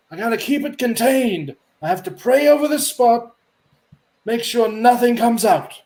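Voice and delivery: high, pious priest voice; a fussy holy man, busy but polite. Acted. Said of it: "he stops and goes".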